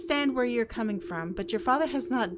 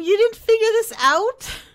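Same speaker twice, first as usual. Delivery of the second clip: incredulous; 10 semitones higher, 10 dB louder than usual. Real, not acted. Player exasperated at other player.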